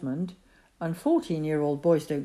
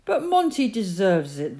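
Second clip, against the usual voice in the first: pleading; 4 semitones higher, 6 dB louder than usual. A narrator's reading. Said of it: "the boy pleaded".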